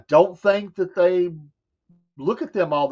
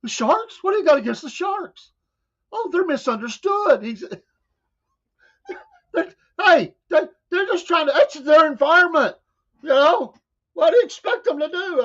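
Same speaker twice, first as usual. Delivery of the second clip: high, mock-indignant defense of sharks; indignant guest; 10 semitones higher than usual. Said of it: "He's like".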